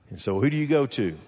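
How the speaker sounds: ordinary talk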